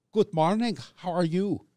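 high, mock Russian woman's greeting, lightly accented; a bad Russian accent, friendly and bright; hammed-up Russian accent greeting; terrible Russian accent. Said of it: "That's a terrible Russian accent".

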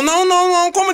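no no no, rising in outrage; shocked protest. Real, not acted. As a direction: pitch jumping way up in disbelief. Game unlocks car already bought.

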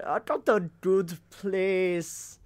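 put-on slow, hammy Scottish accent; a weak Scottish accent, drawn out. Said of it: "me trying to do a Scottish accent".

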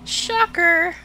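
high, loud mock amazement; hammed-up sarcastic surprise; sarcastic shocker. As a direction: exclaim it in fake shock. Sarcastic reaction.

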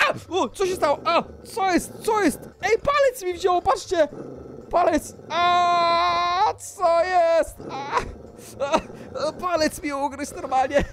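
high-pitched mock pain and shock; alarmed yelping. Player whose finger got bitten, alarmed yelps. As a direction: yelp as if bitten.

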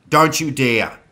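forceful shouted warning; booming warning. A narrator's reading. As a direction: boom it out as a command. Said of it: "Zoe boomed".

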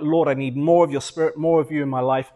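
ordinary talk, nothing marked

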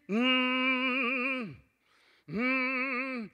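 soft closed-mouth moaning; hummed moan. Retold or imitated. Preacher recalling his aunt, soft hummed moan. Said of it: "My aunt used to moan".

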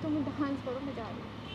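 almost swallow the words as you turn away; tearful murmur. Real, not acted. Hurt girl refusing his dance, tearful soft murmur.

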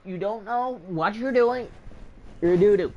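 high, teasing taunt; mocking trash talk. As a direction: jeer at him in a squeaky voice. Mock trash talk to opponent.

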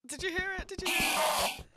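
high, loud and urgent; excited alarm. Real, not acted. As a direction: jolt up in alarm at the noise. Spooky cave noise heard.

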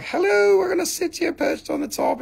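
very high, cute little-creature squeal; tiny crystal's voice. Acted. Little amethyst greeting buyers from the top, tiny voice. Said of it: "It's like".

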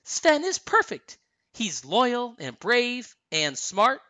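high, enthusiastic praise; high, thrilled approval; delighted praise. A narrator's reading. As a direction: gush in a high voice, slowing over the list. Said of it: "clapping her hands".

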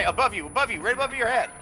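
ordinary talk, nothing marked

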